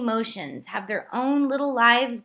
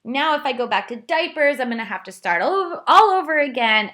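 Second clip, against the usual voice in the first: much higher and louder, breaking into sobs; retold sobbing; 6 semitones higher, 7 dB louder than usual. Mother reliving her potty-training meltdown, sobbing and louder. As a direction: wail it like you're falling apart. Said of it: "and I'm sobbing".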